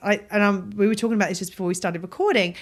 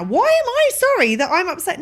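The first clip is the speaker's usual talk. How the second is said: higher-pitched, incredulous protest; indignant self-questioning; 9 semitones higher than usual. Acted. Questioning an apology for being upset, indignant disbelief. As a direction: challenge your own apology in disbelief.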